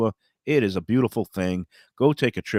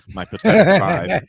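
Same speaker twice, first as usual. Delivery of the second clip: laughing; 8 semitones higher, 8 dB louder than usual. Real, not acted. Self-mocking his hot dog tally.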